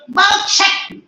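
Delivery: high, loud order to shut up; sharp scolding shout. Real, not acted. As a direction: cut them off with a sudden shout. Teacher abruptly silences talking student.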